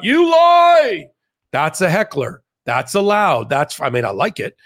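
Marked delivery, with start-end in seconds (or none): 0.0-1.1 high, loud drawn-out shout; mock outburst from the crowd, pitched way up; imitated heckler's yell. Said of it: "yells out".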